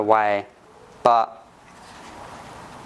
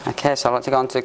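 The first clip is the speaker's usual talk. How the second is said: ordinary talk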